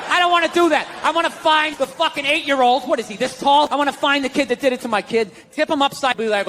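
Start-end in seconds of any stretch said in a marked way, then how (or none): none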